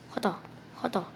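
unvoiced grumbling curse; cursing under breath. Real, not acted. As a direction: mutter it breathlessly to yourself. Cursing at game boss. (숨죽여 욕하기)

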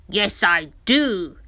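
lightly growled, loud and slow; a slow, loud hint of crocodile growl. A narrator's reading. Crocodile answering Dudu, with a slow, loud growl. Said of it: "growled the crocodile".

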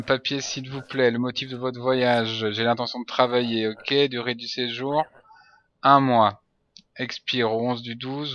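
ordinary talk

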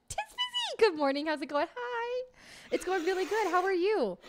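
high, loud delighted hello; excited greeting. Real, not acted. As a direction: greet a friend with joy. Excited greeting to chat member.

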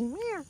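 high mock meow; imitated meow. Meowing at kittens.

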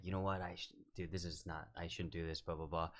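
low, very soft self-doubt; retold anxiety. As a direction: quietly talk yourself out of it. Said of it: "I had all this anxiety".

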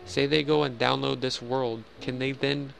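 usual speaking voice

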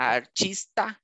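"artista" slowly as "ar-CHEES-ta". Shown as a demonstration. Teacher models slowly for student, "artista" as "ar-CHEES-ta". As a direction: stretch "artista" slowly, with CH in the middle. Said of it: "só mudaria o T I".

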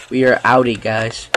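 ordinary delivery

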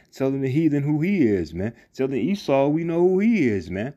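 ordinary talk, nothing marked

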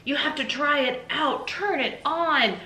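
high, insistent excitement; pushy, high-pitched enthusiasm; eager husband urging. Acted. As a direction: urge her on, stretching the final words. Husband urges her to try present.

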